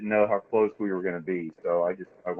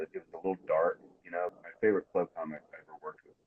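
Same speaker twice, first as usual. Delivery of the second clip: ordinary talk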